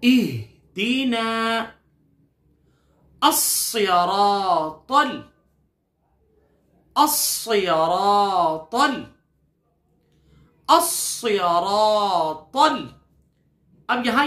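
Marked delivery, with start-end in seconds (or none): 0.0-1.6 slow, drawn-out tajweed chanting; measured Quranic recitation. Shown as a demonstration. Teacher recites Quran for repetition. 3.2-12.9 drawn-out tajweed recitation of a fragment; slow chanted recitation; slow Quran recitation. Shown as a demonstration. Teacher recites fragment for repetition.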